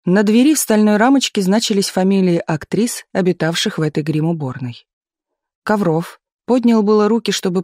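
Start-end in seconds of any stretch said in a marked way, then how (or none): none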